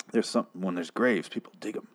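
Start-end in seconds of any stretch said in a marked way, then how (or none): none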